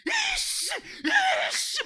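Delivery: high, drawn-out threatening hiss; mock angry seething noise. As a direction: imitate someone winding up to hit. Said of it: "막 이렇게 막 때리려고 그러더라".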